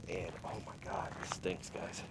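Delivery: hushed whisper, recoiling from the stink; disgusted whisper. Real, not acted. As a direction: breathe it out in disgust, barely voiced. Disgusted at a smelly car.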